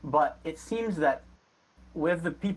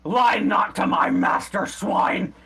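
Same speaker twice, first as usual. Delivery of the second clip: louder, raised henchman's bark; barked threat; 5 semitones higher, 4 dB louder, slower than usual. Acted. Henchman accuses beggar of lying to his master, barking. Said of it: "a third voice barks up".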